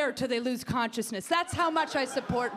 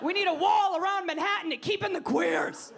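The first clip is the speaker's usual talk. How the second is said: higher, emphatic ranting list; mock Carly ranting; 6 semitones higher than usual. Comic mocking a candidate's wild demands, higher. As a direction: play the candidate demanding absurd things. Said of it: "She's like".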